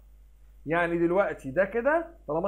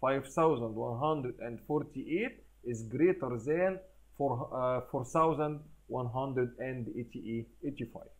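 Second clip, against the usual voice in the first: very slow and lower, careful reading; slow read aloud; 5 semitones lower, 5 dB quieter, much slower than usual. Shown as a demonstration. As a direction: read it out slowly, step by step. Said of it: "نقول ايه".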